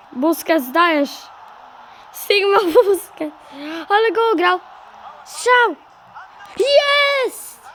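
building, high, loud cheer; excited. Real, not acted. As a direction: ride the attack up to the goal yell. Attack leading to goal.

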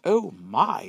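put-on old woman suddenly alarmed; alarmed surprise. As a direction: gasp it out as she smells burning. Old woman smells burning gingerbread.

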